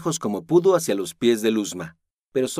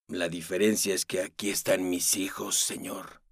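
quiet; 3 semitones lower, 6 dB quieter than usual. Acted. Tired father explains refusal.

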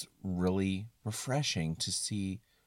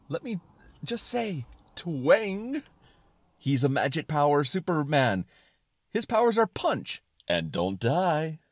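high, emphatic excited explaining; excited suspenders; 6 semitones higher, 4 dB louder than usual. Acted. Suspenders of disbelief explaining hero's powers, excited. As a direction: punch it out with eager conviction. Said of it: "talk in an excited tone".

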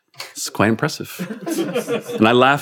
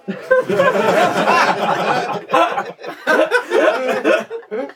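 high laughter rolling on; long laughing fit; 13 semitones higher than usual. Real, not acted. Reader laughing at the butt's request, a long laughing fit. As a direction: break down laughing. Reader cracks up at own story.

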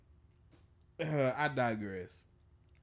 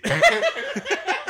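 loud laugh; 11 dB louder than usual. Real, not acted. Laughing at friend's fall.

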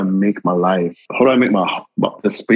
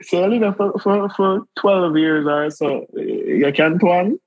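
much higher, slow doubting question; mocking quote; 10 semitones higher, slower than usual. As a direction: tease like someone who can't believe it. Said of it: "people be like".

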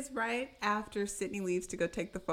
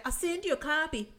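pumped-up, high-pitched bragging; super bubbly; 9 semitones higher than usual. Retold or imitated. Tatum rehashing the punch to her friend, super pumped. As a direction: replay the punch gleefully, punching out the exclamation. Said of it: "like, super bubbly".

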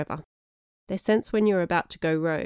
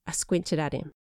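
ordinary delivery, nothing marked

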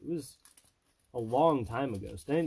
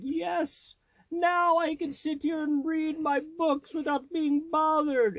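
much higher, slow, delighted nerdy voice; gleeful bookworm; slower than usual. Retold or imitated. A lonely reader rejoicing at last, in a high gleeful mock voice. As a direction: savour the peace, hammed up.

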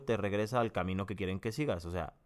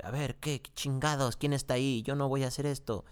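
higher, agitated mock rant; paranoid outburst; 6 semitones higher than usual. Programmer panics at the unseen controller, higher, agitated rant. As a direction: play the programmer losing his mind. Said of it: "el tipo ya empieza como de".

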